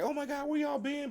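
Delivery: high, surprised oh my God; high surprised greeting. Retold or imitated. Nora greeting returning friends, high surprised squeal. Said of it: "Nora's like".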